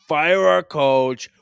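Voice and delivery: put-on Boston accent, slow angry Toronto fan; Boston accent, slow and loud like a fed-up fan; hammed-up Boston accent yelling at the team; mock Boston fan. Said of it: "talk like they're from Boston".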